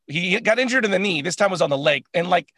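normal talking voice

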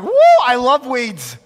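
high, loud and slow cheer; mock enthusiasm. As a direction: gush over weeds as if they were a treat. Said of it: "But if you're like".

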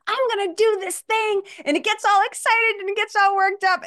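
high, giddy worked-up voice; excited kid. Acted. Conscious mind voiced as a giddy kid, high and excited. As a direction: squeal it like an overexcited kid.

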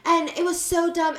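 ordinary talk

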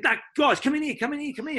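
high calling-in voice; imitating Sheeds herding. Coach herding young players into his meeting room, high call. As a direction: wave the kids in, a lightly voiced coach. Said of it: "he said".

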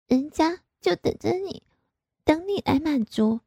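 high, coy and petulant; coy sulk. A narrator's reading. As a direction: pout the line at him. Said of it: "我哼了一声". (撒娇赌气)

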